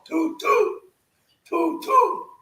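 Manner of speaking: high, hooted toot toot; train whistle call. Acted. Imitates a train whistle, call-and-response.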